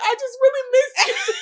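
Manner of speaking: high, laughing-crying plea; teary confession to her friend; tearful retelling. Retold or imitated. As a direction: squeak it out half laughing, half tearing up. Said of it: "I literally started crying".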